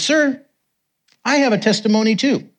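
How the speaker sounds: high-pitched imitated declaration; retold eager announcement. Man coming up to the preacher, eagerly announcing. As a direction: proudly announce it, pitched well up.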